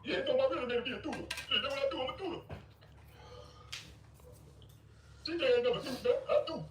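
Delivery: high, silly singsong mockery of a rival; mocking high goofy voice. Streamer mocking a grown man's boasting, high goofy voice. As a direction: parody his boast in a dopey high voice. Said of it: "listen to this little grown child".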